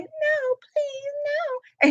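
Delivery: high, tearful little pleading voice; mock pleading. Retelling how she begged him, in a high crying plea. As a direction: plead up high like she's about to cry.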